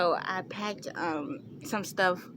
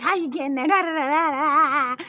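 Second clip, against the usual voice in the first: freaking out; 8 semitones higher, 10 dB louder than usual. Retold or imitated. Daughter mimics mom freaking out over the ride. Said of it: "Like, freaking out".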